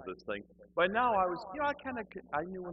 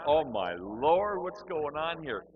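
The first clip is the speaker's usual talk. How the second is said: slow, high-pitched appalled exclamation; mock dismay; 7 semitones higher, 5 dB louder, much slower than usual. Bewildered newcomer reacting to the shouting minister, in mock dismay. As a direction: stretch it out in comic alarm. His alarm at the revved-up service.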